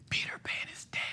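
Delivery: very soft, voiceless whisper; retold whisper. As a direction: whisper it like a secret taunt. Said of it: "went up to him and whispered".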